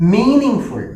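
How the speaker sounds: Meaning ഫുൾ opened high and loud; first-syllable stress. Shown as a demonstration. Teacher showing stress stays, start of meaning ഫുൾ punched. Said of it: "first വേർഡിലാണ്".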